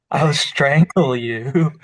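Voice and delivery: playful menace, said slowly; mock threat. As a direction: draw the threat out in jest. Joking threat after accent plan, laughing.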